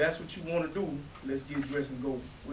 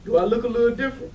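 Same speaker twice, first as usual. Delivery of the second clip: excited; 6 semitones higher, 9 dB louder, faster than usual. Acted. Teasing big news.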